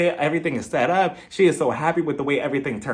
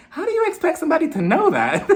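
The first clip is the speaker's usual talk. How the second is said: high-pitched baffled question ending in laughter; incredulous; 12 semitones higher than usual. Retold or imitated. Party guest baffled by impossible trivia, high and incredulous. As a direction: protest in squeaky, amused disbelief.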